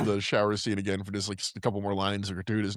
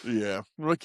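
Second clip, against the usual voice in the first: ordinary delivery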